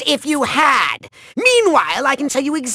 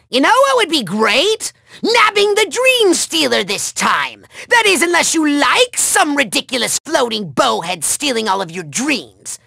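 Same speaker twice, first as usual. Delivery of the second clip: normal talking voice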